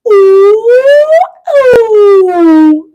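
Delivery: high, loud monkey ooh calls; full-throated ape hooting; monkey hoots. Shown as a demonstration. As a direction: hoot it out like a monkey. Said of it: "You'll have your own sound".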